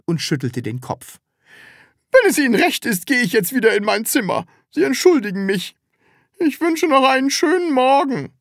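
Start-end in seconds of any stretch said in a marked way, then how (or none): 2.1-8.3 high, strained-polite landlady voice; high-voiced, courteous landlady leaving; flustered landlady, polite. A narrator's reading. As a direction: excuse yourself at a high pitch. Landlady Frau Rotkohl takes her leave.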